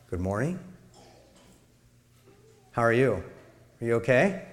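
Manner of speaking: soft, quiet, caring check-in; concerned. Shown as a demonstration. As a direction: ask gently if they are okay. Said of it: "Tone of voice kind of puts some extra meaning".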